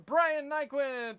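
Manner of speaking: loud, drawn-out name call; winner announcement. Real, not acted.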